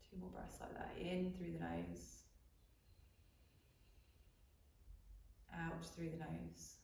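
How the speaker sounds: soft, unhurried meditation voice with long pauses; guided meditation. Real, not acted. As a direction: guide them quietly into breath and body awareness. Leads a breathing body scan.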